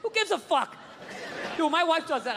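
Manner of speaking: ordinary talk, nothing marked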